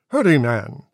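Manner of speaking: ordinary delivery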